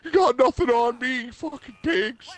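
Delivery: very high, loud mock shout; hammed-up bar brawler taunting cops; defiant drunk. Buff Sunderland yelling at police escorting him. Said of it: "he's like".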